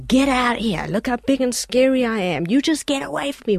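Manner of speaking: mock-scary, lightly puffed-up threat; gorilla threat. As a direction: act like a big scary gorilla warning off danger. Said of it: "and says".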